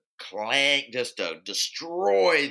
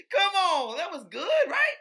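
laughing, squeaky-high plea for credit; high-pitched protest. Real, not acted. Defending a Morgan Freeman voice to a friend, high-pitched laughing protest.

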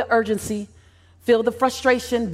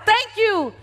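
yelled thanks; 11 semitones higher than usual. Pretend. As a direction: shout it up to the stage. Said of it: "seat four hundred and twenty-five".

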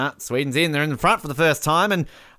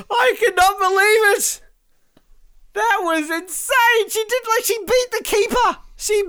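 real overjoyed commentary yell; ecstatic. Real, not acted. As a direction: cry out like gold just got won. Winning penalty scored.

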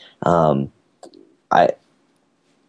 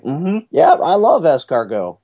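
higher-pitched mock enthusiasm; imitating an eager boy; 7 semitones higher than usual. Quoting nine-year-old after his first escargot, mock enthusiasm. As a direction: play a kid insisting he loves it. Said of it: "he's like".

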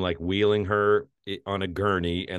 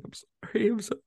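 very high, thin mock sobbing; imitated crying; faster than usual. Reviewer imitates him clutching the steering wheel, mock crying. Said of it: "And he's crying".

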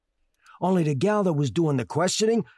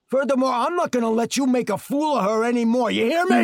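angry warning; 6 semitones higher, 3 dB louder than usual. Acted. Defending his wife angrily.

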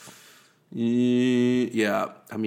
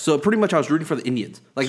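ordinary delivery

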